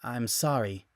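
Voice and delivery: soft, quiet apology; whispered. Acted. Samus whispering to the Metroid before shooting it. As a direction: breathe it gently before firing. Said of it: "she whispered".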